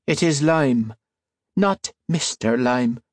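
high, slow Irish voice, stressing not; reedy correction. A narrator's reading. Lime corrects the priest, high, slow and reedy. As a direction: correct him coldly with a lilt.

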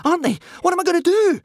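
high, loud panic; frantic, high-pitched alarm; panicked. A narrator's reading. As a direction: wail it in rising desperation. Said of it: "panicked Hiccup".

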